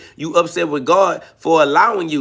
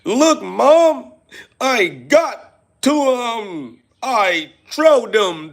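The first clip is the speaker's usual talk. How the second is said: hammed-up whining kid voice, higher and slow, with a mock cry; whiny child to mom; 4 semitones higher, slower than usual. Streamer mocks the troll calling to his mom, slow mock crying whine. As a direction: beg mommy for praise, whimpering.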